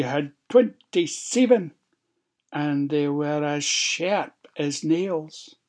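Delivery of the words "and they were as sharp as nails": ordinary delivery, nothing marked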